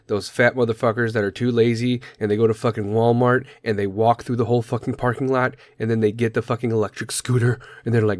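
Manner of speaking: usual speaking voice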